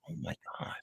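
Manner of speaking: hushed, whispered gasp of disbelief; whispered astonishment. Retold or imitated. Crafter recalls seeing what her yarn could sell for, whispered astonishment. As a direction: whisper it, as if stunned by the total. Retold shock at the prices.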